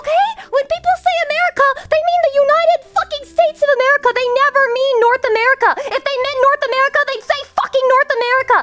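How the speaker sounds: high, fast, shrieking rant; mock-outraged rant at a chatter; hammed-up furious rant. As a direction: screech it like an offended patriot. Ranting at chatter about America.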